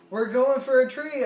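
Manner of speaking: mock-alarmed shout; retold alarm. Retold warning just before crash.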